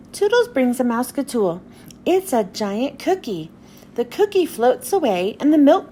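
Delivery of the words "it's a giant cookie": plain reading voice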